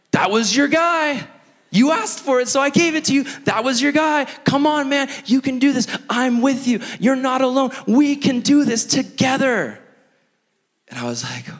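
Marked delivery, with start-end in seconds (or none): 0.1-9.8 high-pitched, insistent urging; exasperated pep talk. Retold or imitated. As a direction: plead like a coach pushing a reluctant player. The Lord urging him on, retold.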